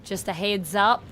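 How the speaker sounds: trying out an Australian accent, vowels wandering; best go at an Australian accent; attempted Australian accent. Shown as a demonstration. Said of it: "try to do my best Australian accent".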